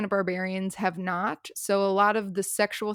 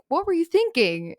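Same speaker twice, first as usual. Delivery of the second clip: higher, louder, scolding her for being reckless; exasperated scold; 8 semitones higher, 4 dB louder than usual. Retold or imitated. Rahosh rescues Liz, then a loud exasperated scold.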